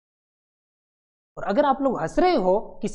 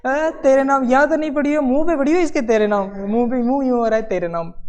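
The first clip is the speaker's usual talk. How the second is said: higher, louder taunting laugh-talk; loud jeering mockery; 6 semitones higher, 7 dB louder than usual. Court jeers at the man's face, loud taunting. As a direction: taunt him loudly, half laughing.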